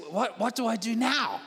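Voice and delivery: high-pitched, stammered plea; mock panic. Diner asking his pastor for help, mock panic. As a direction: play up the helpless alarm.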